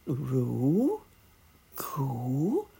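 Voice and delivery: rising dove call; gentle cooing. A narrator's reading. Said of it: "called the dove gently".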